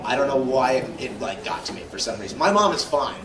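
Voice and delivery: ordinary delivery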